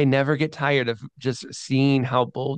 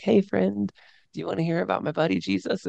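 higher, fast, overly sweet mock-friendly voice; mock gentle sell; 7 semitones higher than usual. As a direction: coax like a timid youth pastor. Mocking today's gentle evangelism.

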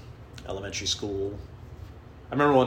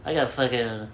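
mocking brag; 3 semitones higher than usual. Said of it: "wear their fucking mental illness".

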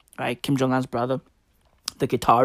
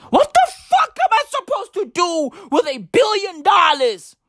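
much higher, hammed-up shout; mock outrage; 10 semitones higher than usual. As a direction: fake indignation, played big. Mock complaint about billion dollars.

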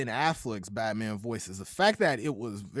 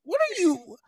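mock disbelief; 8 semitones higher, 4 dB louder than usual. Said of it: "I'm like".

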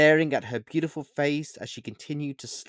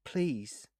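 soft, tearful please; 3 semitones higher, 7 dB quieter than usual. A narrator's reading. Boy pleading with tears in his eyes. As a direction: let the tears come through. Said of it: "I pleaded with tears in my eyes".

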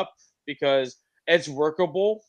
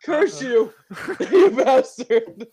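breathless laughing curse; gasping through laughter; after laughing hard; 7 semitones higher, 5 dB louder, slower than usual. Real, not acted. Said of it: "my face hurts from laughing".

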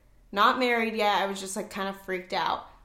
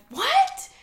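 very high, loud retold "what"; shocked shriek; 6 dB louder than usual. Friends freaking out at pregnancy news during a toast, shocked shriek. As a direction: shoot way up in pitch, imitating freaked-out friends. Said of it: "they, like, freaked out".

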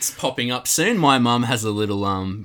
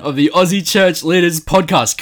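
excited intro; 9 semitones higher, 11 dB louder, slower than usual. Real, not acted. Hosts open the podcast loudly.

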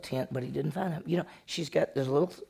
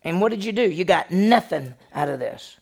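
louder, higher indignant complaint; Jacob getting mad; 5 semitones higher, 7 dB louder than usual. Retold or imitated. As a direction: scold him for chasing you down. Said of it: "Jacob's fixing to be mad".

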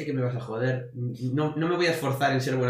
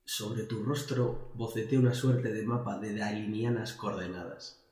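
poetry declamation; 5 dB quieter than usual. Shown as a demonstration. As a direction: declaim it like a poet. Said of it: "voy a declamar". (declamación poética, lenta y solemne)

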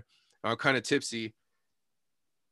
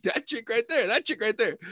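urgent pointing-out; 10 semitones higher than usual. Retold or imitated. Retold pointing out the culprit.

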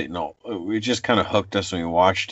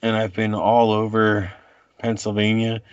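normal talking voice

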